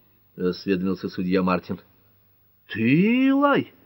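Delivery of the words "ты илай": very high, incredulous question; stunned disbelief, voice shooting up; astonished. A narrator's reading. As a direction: exclaim in amazement, pitch soaring. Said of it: "удивлённо воскликнул Джон Ф".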